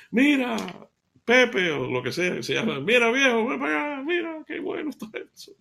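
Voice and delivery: high, excited call to her husband. Acted. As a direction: call him over, thrilled and amazed. Mujer llama al esposo emocionada. (llamada emocionada al esposo)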